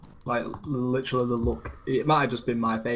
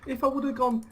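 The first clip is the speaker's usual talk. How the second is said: laughing. Real, not acted. Laughing retelling his reply.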